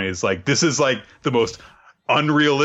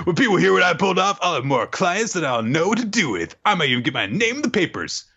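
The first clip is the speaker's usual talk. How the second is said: pitched up, quick excited boast; high fast bragging spy; 8 semitones higher than usual. Retold or imitated. Recapping the spy's daydream, high and eager.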